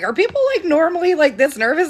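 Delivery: high, anxious voice, imitating her nervous self; nervous question. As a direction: squeak it out like a jittery first-timer. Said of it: "I was like".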